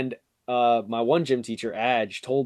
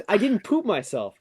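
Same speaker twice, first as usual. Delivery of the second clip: indignant denial; 8 semitones higher than usual. Retold or imitated.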